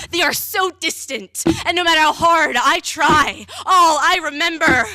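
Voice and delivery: loud, forceful slam-poem climax; anguished outcry. Acted. Poet relives being struck with an axe, anguished outcry. Memories recited between axe blows.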